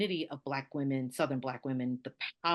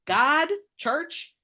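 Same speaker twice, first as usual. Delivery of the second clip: much higher and louder, building the list with gusto; excited; 12 semitones higher, 11 dB louder, much slower than usual. Real, not acted. Listing Southern life's essentials to the host, high and excited. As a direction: rattle it off with delight.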